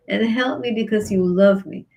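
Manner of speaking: normal talking voice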